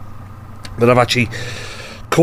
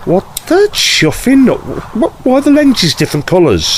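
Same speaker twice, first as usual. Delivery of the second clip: startled outburst; 9 semitones higher than usual. Acted. Rhodes startled by the glasses.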